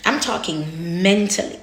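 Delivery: usual speaking voice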